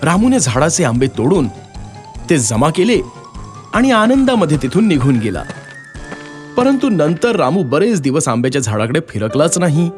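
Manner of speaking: plain reading voice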